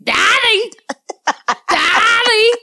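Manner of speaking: put-on very high child's cry, DADDY with thick lisped d's; toddler mispronunciation. Parent imitating son calling dad, squealed lisped DADDY. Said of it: "That's how he says daddy".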